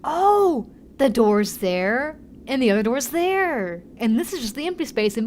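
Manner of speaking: slow, drawn-out wonder; amazed discovery. Real, not acted. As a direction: marvel slowly at each door. Noticing the doors.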